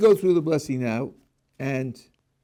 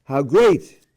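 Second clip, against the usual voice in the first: louder awed How great; a louder exclaiming How great; exclamation; 7 dB louder than usual. Shown as a demonstration. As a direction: burst out in wonder. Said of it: "it's an exclamation".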